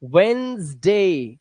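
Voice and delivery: Wednesday drawn out as "wennnz-daaay"; very slow model for learners; slow pronunciation demo. Shown as a demonstration. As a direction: stretch both syllables of Wednesday. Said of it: "बस इतना पढ़ो इसे".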